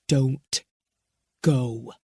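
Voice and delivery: low, firm, hard-edged order; a man refusing to be argued with. A narrator's reading. Bowman orders Riley not to go, hard and low. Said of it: "His voice was diamond hard".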